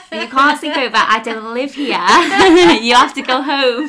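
put-on cracking up while telling the kid off; laughing retort. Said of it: "And I'm like".

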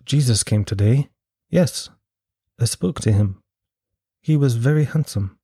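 period-film English twist; slight English accent. Acted. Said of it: "a slight English twist to their accent".

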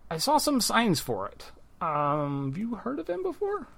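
chatty gnome shopkeeper. Acted. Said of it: "She does go".

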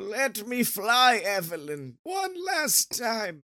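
put-on slow, high, frail old man cry. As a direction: plead like a dying grandpa. Continuing the grandpa bit.

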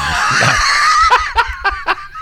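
high-pitched burst of laughter; laughing. Real, not acted. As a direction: crack up in a high squeal. Laughing at the wrong guess.

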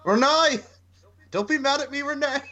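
high-pitched, hammed-up plea; mock pleading husband. Small husband begs his wife not to be mad, mock pleading. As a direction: call out and beg the wife.

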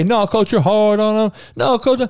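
mock the complaining player; whiny player. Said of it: "You don't need to say".

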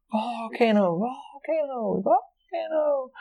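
high, slow drawn-out volcano call; mock restaurant announcer chant, drawn out and high; staff yelling volcano. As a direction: stretch it out like restaurant staff hyping a show. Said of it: "they had to redo the volcano".

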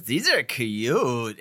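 slow, drawn-out incantation; magic words. Acted. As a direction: stretch it out like a spell. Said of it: "and she says".

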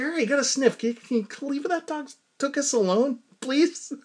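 high-pitched plea to a nosy dog; high mock scolding. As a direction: coax the dog in a high, pleading voice. Scolding her dog Lola.

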